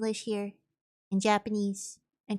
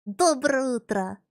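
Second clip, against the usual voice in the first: loud, emphatic put-on Russian voice; Russian accent; 2 semitones higher, 6 dB louder, slower than usual. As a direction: introduce them with a hammed-up Russian accent. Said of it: "hear my Russian accent".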